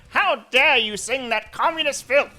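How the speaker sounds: furious guard roaring at the singing woman; outraged Nazi shout. Pretend. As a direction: bellow it like an offended guard. Nazi guard yells at singer.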